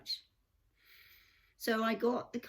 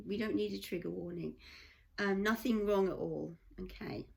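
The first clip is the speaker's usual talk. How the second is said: normal talking voice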